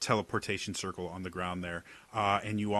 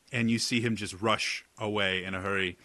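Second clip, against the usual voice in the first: ordinary delivery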